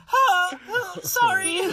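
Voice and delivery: high, gasping panicked sorry; startled apology. Retold or imitated. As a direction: yelp it like you've been caught. Harry caught by screaming dad.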